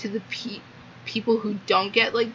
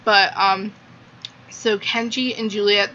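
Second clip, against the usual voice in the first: normal talking voice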